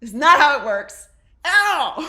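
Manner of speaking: loud, high exasperated cry; exasperated shout; worked-up outburst. Real, not acted. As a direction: yell it in disbelief. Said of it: "Okay, calm down".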